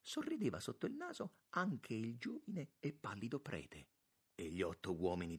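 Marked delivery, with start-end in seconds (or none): none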